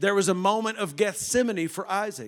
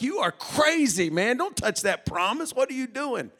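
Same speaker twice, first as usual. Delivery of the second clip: high, loud disbelief; incredulous shout; 7 semitones higher, 4 dB louder than usual. Acted. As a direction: call out in alarm. Angel scolding Abraham.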